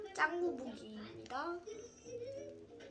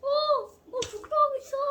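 high, loud excited exclaims; 10 semitones higher, 9 dB louder than usual. Real, not acted. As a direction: gush with delight. Excited reaction watching cartoon.

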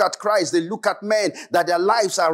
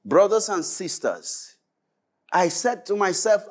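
usual speaking voice

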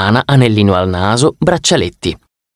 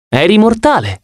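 Annabeth recounting; 10 semitones higher than usual. Acted.

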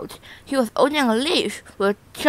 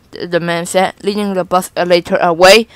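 plain reading voice

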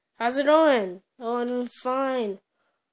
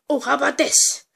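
usual speaking voice

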